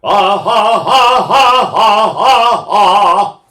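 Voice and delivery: string of loud punched ahs; repeated diaphragm accents. Shown as a demonstration. Singer showing students a run of accents, loud and punched. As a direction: hammer every ah from the diaphragm. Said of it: "I can keep doing those accents".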